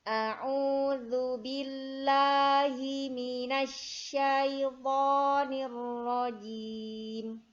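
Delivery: slow, loud melodic chanting; Quran recitation. Shown as a demonstration. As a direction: chant it slowly like murottal. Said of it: "Kita baca ta'awud".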